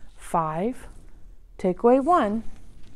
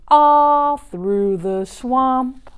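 slow drawn out; 5 dB louder, much slower than usual. Pretend. Slowed end of chant.

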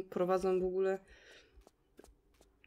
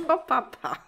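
wysokie, głośne pożegnanie ze śmiechem; pożegnaj się, jeszcze się śmiejąc; pożegnanie przez śmiech; 7 semitones higher, 8 dB louder, slower than usual. Real, not acted. Żegna się tuż po wybuchu śmiechu. (roześmiane, głośne pa, pa)

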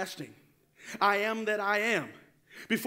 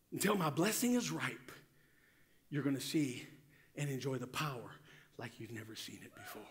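usual speaking voice

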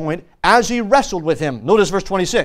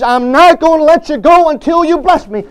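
higher, louder insistent plea; urgent, raised-voice beggar's demand; begging; 5 semitones higher, 5 dB louder than usual. Acted. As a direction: beg forcefully, refusing to give up. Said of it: "You see, he's begging".

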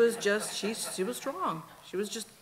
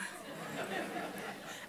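soft breathy laugh; 10 dB quieter than usual. Real, not acted. Laughing at her own story.

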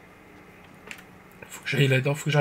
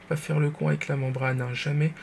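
whisper; 6 dB quieter than usual. Real, not acted. Whispered warning to himself.

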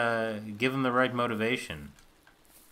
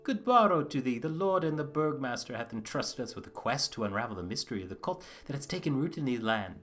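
higher-pitched theatrical medieval voice; put-on period-drama voice, pitched up; formal old-world speaker; 6 semitones higher than usual. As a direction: recite a quest in courtly period speech. Voices another villager's dialogue.

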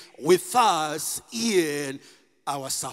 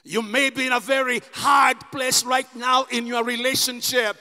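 a raised, driving preaching voice; fervent preaching; 3 semitones higher than usual. Acted. Pastor assuring the church of new life, fervent preaching. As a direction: press each promise home to the congregation.

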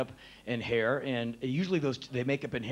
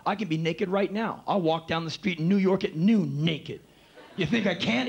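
macho bravado; 5 semitones higher, 5 dB louder than usual. Retold or imitated. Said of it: "my macho bullshit".

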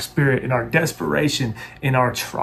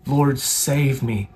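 slow plea; much slower than usual. Shown as a demonstration. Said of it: "this is the prayer to pray".

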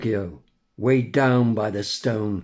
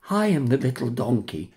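ordinary delivery, nothing marked

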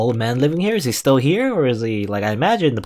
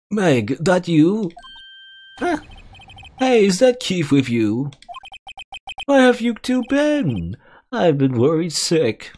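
put-on old man voice; 8 semitones higher, slower than usual.

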